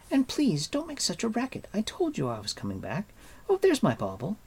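put-on high, chiding young girl. A narrator's reading. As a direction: scold like a bossy girl. Narrator voices girl Elanwi.

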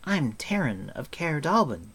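high-voiced attempt at boldness; trying bold. A narrator's reading. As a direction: announce yourself, trying to sound brave. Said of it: "trying to appear bold".

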